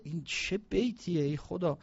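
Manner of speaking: ordinary talk, nothing marked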